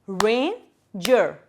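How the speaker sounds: "Ranger" as two beats, RAIN, then JER; split into syllables. Shown as a demonstration. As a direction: pause inside "Ranger" between its two syllables. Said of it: "two syllables".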